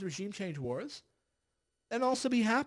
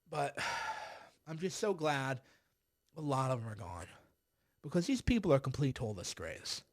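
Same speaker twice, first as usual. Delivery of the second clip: ordinary talk, nothing marked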